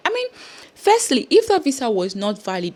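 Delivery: ordinary talk, nothing marked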